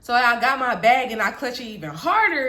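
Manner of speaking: ordinary delivery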